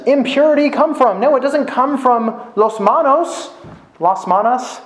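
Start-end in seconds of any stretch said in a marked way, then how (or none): 2.5-4.8 "los manos" and "Las manos" said with Spanish vowels, LOHS MAH-nohs; playful switch to Spanish on "Las manos"; going Spanish. Real, not acted. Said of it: "Why am I going Spanish?".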